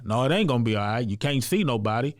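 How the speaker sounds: the devil on his shoulder mocking him, building; devil's voice. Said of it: "Then I got the devil on the other side".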